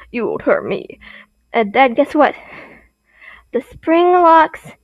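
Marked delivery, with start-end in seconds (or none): 0.1-1.0 smug whisper of a hiding villain; whispered taunt. Acted. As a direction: breathe it out as a sneering secret. Villain taunting souls from suit.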